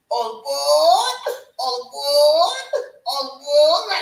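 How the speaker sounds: loud, high, drawn-out call; conductor's boarding call. Acted. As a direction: holler it out like a train conductor. Plays train conductor calling out.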